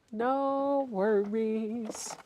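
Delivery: very slow, stretched-out "No worries"; drawn-out. Real, not acted. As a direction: stretch each vowel of "No worries" way out. Same reply repeated, stretched out.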